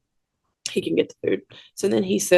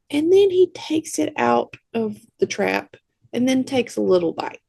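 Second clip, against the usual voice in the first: ordinary talk, nothing marked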